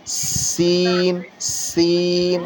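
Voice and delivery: سین stretched very slowly with a long hissing whistle; whistling seen. Shown as a demonstration. Tutor demonstrating a whistling سین. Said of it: "اس کو سیٹی کی طرح پڑھنا ہے".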